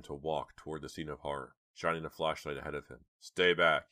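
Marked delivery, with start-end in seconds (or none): 3.2-3.9 loud sharp warning. A narrator's reading. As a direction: warn them off. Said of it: "he warned the girls".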